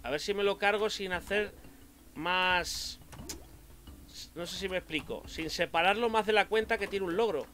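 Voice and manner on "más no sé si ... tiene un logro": ordinary talk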